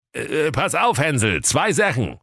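high, hurried wolf; wolf squashed under a child. A narrator's reading. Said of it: "sprach der Wolf". (hoher Wolf, bedrängt)

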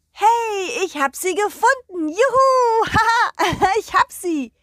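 loud, high, jubilant whoop; excited cheer. Acted. The dog cheers at finding the chickens, loud and excited. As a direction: shout for joy at finding them.

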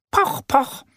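high voiced poch poch knock; knocking sounds. Annie knocking at Mo's door, playful voiced knock. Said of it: "klopft Annie an Moos Tür".

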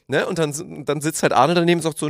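normal talking voice

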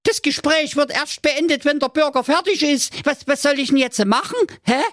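put-on high-pitched fretful young prince; a pampered prince in a panic; whiny high prince. Prince protests. (hohe, weinerliche Prinzenstimme)

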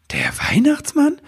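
high, amazed question; astonished. A narrator's reading. Elf woman hears who gave the order, astonished. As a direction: let the pitch leap up in wonder. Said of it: "fragte Nebelwurz verwundert".

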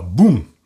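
loud, low crash boom; imitated crash. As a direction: drop the voice into a sudden boom. Imitates car hitting a wall.

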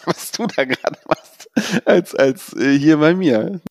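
laughing while teasing. Real, not acted. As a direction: chuckle through the words. Teasing laugh about vacation.

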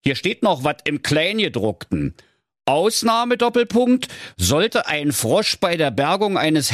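high, deliberate rule-reading; father. Acted. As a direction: read the clause aloud as a pedantic father, punctuation included. Father reads out small print.

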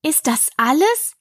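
suppressed annoyance in Alice's voice; hiding annoyance. A narrator's reading. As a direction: ask with irritation held in. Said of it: "ihren Ärger, so gut sie konnte, verbergend".

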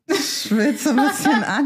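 slow, laughing through it; high giggly complaint. Real, not acted. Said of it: "ich merk jetzt schon so".

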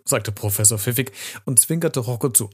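usual speaking voice

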